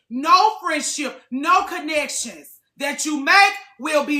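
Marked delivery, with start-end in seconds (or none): none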